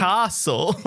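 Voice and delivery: mock puzzled "KAH-sul"; baffled echo of "castle". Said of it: "What is a ca".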